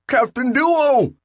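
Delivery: high, slow, frightened shriek; shriek in fear. Acted. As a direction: scream for help, terrified. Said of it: "Bill shrieked in fear".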